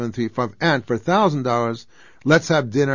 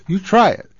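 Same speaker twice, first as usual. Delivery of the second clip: emphatic challenge; 10 semitones higher, 8 dB louder than usual. Real, not acted. Challenge to the listeners, peak of the rant.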